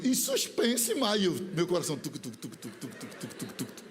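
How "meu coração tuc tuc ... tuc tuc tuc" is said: very low, soft thumping tuc tuc; heartbeat sound. Retold or imitated. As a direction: imitate a pounding heart quietly. Heartbeat imitated. (coração batendo, tuc tuc baixinho)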